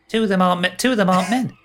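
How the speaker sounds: high, pointed correction; cheeky faun boy. Acted. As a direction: correct him like a sharp little faun. Faun corrects player, voiced high.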